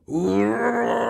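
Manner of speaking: high, rising lion roar; Löwenschrei. A narrator's reading. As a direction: let out a long lion's cry. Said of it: "schrie der Löwe". (hoher, langer Löwenschrei)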